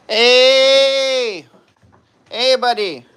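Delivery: high, drawn-out "hey" in a hammed-up northern accent; put-on accent. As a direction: call out to a buddy in a joke accent. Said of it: "Do they cut with an accent".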